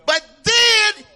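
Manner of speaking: high, loud, rising gospel preaching; climbing shouted sermon cadence; preaching at full force. Acted. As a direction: whoop it up from the pulpit, slowing on the big words. Preaching to the congregation.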